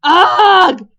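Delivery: shouted cry of disgust; loud disgusted roar. A narrator's reading. Stoic entering to find sick on his pillow, disgusted roar. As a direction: roar out in revulsion. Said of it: "said Stoic the Vast".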